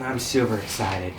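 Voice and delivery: flat deadpan excitement; sarcastic. Real, not acted. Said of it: "He is super sarcastically excited".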